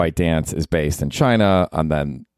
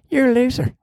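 high, mocking old granny jab. Granny heckling her grandson with a high mocking jab. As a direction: squeak it out like a snippy grandma. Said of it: "Granny, be nice".

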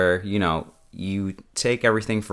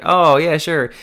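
high, loud "oh" of realization; sudden breezy agreement; 9 semitones higher, 6 dB louder than usual. Acted. Landlady hearing it is about the apartment, suddenly obliging. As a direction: switch brightly to obliging.

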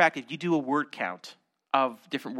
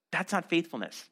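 ordinary delivery

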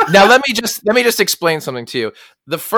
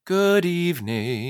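slow, higher, softer drawn-out greeting; passersby greeting; 5 semitones higher, 6 dB quieter, slower than usual. Acted. People passing in Central Park, drawn-out greeting. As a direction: lilt it slowly, softly, as a stagey hello. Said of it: "would walk by each other and be like".

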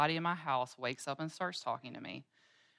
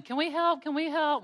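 put-on much higher, louder eager children's voices; eager kids; 12 semitones higher, 8 dB louder than usual. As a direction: beg to help like excited little boys. Boys jumping in eagerly.

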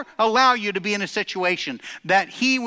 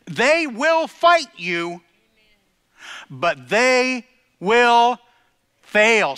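slow, then loud and high on fail; building preacher's cry; 6 semitones higher, 6 dB louder, much slower than usual. Acted. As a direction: drag it out and slam fail. Building climax of sermon.

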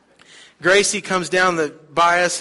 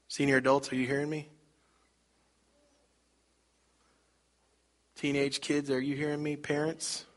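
quiet direct appeal; 8 dB quieter than usual. Acted. Preacher addresses congregation groups.

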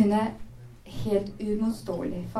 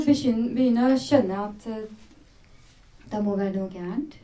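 normal talking voice

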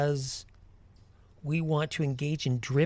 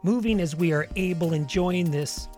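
guided meditation. Acted. Leads a walking meditation.

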